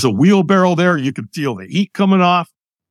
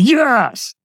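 half-strength shout; 9 semitones higher than usual. Retold or imitated. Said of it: "And I went".

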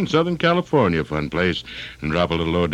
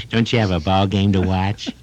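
wife's voice. Retold or imitated. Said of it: "they say".

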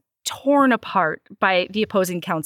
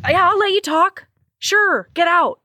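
high, brisk brush-off; mock-indignant dismissal; 8 semitones higher, 4 dB louder than usual. Woman sending away her apologizing man, mock indignant. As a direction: dismiss him high and incredulous. Retold refusal to forgive.

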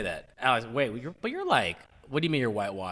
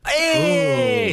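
excited cheer; 12 semitones higher, 5 dB louder than usual. Real, not acted. Cheering a good answer.

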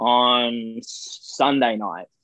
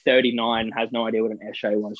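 normal talking voice

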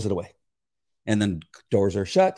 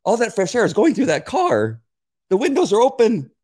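heated arguing; 8 semitones higher than usual. Retold or imitated. Witness pressing the attorney about fresh air. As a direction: hammer the point home. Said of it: "I go".

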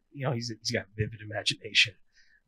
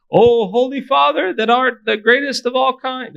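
mock pompous prayer; 13 semitones higher, 15 dB louder than usual. Pastor mocking fancy churchy prayers, high and loud. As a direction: ham up an overly fancy prayer. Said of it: "You know, like that".

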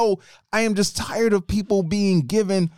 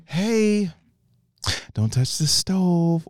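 slow, drawn-out, unhurried caution; mock gentle warning; much slower than usual. Shown as a demonstration. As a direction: warn far too mildly, taking your time. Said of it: "do you say".

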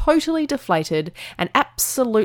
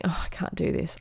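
defeated self-talk; 9 dB quieter than usual. Retold or imitated.

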